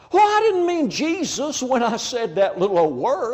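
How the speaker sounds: high-pitched mock whine of denial; hammed-up innocent protest; mock defensive protest. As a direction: play the offended objector, pitch jumping up. Acted protest in imagined argument.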